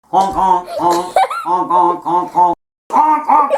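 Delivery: loud silly honking noises; silly goose honk. Pretend. Imitating a honking bird.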